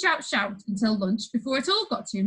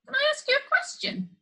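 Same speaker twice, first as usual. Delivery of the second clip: put-on high, hesitant small boy voice; timid boy. A narrator's reading. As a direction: ask it nervously, shrinking back. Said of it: "Joe asked timidly".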